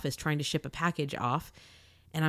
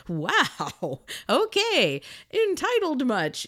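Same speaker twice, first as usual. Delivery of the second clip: much higher, slow, sarcastic "entitled much"; sarcastic retort; 8 semitones higher, much slower than usual. Retold or imitated. Blind woman to entitled stranger, sarcastic and high. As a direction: drip with mock disbelief, pitched way up.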